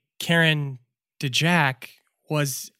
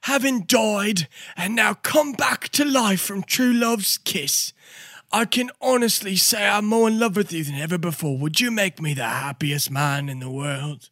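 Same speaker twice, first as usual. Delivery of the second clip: hammed-up proposal; 5 semitones higher than usual. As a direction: propose on one knee, overplayed. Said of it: "gets down on one knee and goes".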